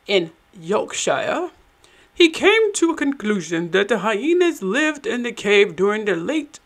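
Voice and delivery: a put-on British accent. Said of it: "I all of a sudden got into the accent".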